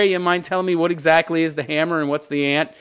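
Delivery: usual speaking voice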